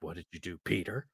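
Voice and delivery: low, quiet threatening question; a father quietly confronting his son; hushed menace. Retold or imitated. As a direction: lean in and ask it softly, dangerously. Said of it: "He's like".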